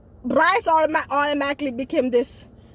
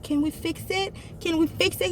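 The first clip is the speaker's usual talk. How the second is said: higher, softer pleading question; retold pleading; 4 semitones higher, 5 dB quieter than usual. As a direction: plead softly in a raised voice. Retold plea to repair relationship.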